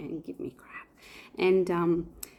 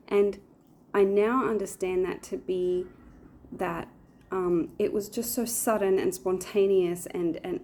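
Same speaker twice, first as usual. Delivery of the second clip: normal talking voice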